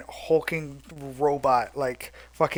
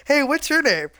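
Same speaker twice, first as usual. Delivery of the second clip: much higher, chirpy little voice; small eager person; 10 semitones higher, 5 dB louder than usual. Retold or imitated. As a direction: play a tiny friendly person greeting giants. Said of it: "this four-foot-eleven person is like".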